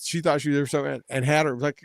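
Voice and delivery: normal talking voice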